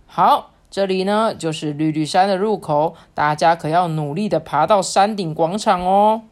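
put-on slow, lilting read-aloud for children; storybook narration. A narrator's reading. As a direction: draw young listeners into the story, unhurried.

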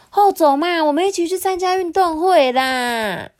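high, wheedling creature voice; eager little gadget begging; pleading talking rice cooker. A narrator's reading. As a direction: coax them in a high cartoon voice.

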